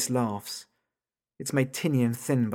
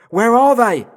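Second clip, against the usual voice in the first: high, loud cry that cracks midway; panicked shout breaking apart; voice cracking; 12 semitones higher, 15 dB louder, slower than usual. Acted. Father shouts at the phone for his kids, voice cracking. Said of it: "My voice cracks halfway through".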